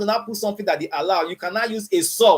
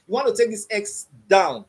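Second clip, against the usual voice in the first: usual speaking voice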